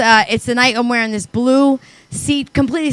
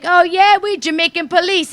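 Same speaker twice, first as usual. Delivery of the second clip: put-on Jamaican accent; 5 semitones higher than usual. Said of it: "they were like".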